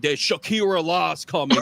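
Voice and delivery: "Shakira" said in place of Sharia; mispronounced Sharia. Retold or imitated. Mocking Staten Islanders' warning, "Shakira" mispronounced for Sharia. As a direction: botch Sharia as "Shakira", with an added k sound. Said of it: "they can't even pronounce it right".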